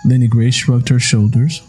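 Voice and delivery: ordinary delivery, nothing marked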